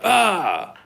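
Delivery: high, loud mock yelp; yelp of pain. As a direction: cry out as if just poked. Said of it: "T J was like".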